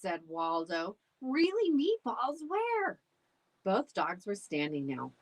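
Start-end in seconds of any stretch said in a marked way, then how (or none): 1.2-3.0 high, eager yelp of a question; excited. A narrator's reading. Dog thrilled to hear of meatballs, high and eager. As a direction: perk up at the word meatballs.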